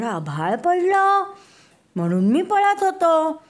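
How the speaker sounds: high, loud anxious rabbit; scared rabbit explaining. Acted. Rabbit telling the lion king why he ran, high and scared. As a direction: blurt it out still frightened. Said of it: "तो म्हणाला".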